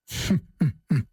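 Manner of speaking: low knowing laugh; 低声冷笑; low chuckle. Acted. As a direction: chuckle as if revealing a secret. Judge Dee chuckles.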